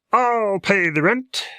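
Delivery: high, loud, slow hero proclamation; heroic voice. Acted. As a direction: announce the rescue boldly. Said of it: "and the hero".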